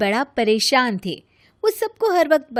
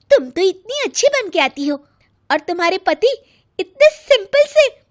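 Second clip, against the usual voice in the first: lightly voiced gossiping friends, higher and louder; mocking the friends; 8 semitones higher, 6 dB louder than usual. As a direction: mimic the catty friends. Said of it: "सब यही कहेंगे".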